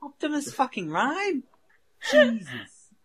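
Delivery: very high, loud incredulous outburst; hyped-up awe. Pretend. Hyping the band with co-hosts, over-the-top awe. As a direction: squeal the band name in overblown disbelief.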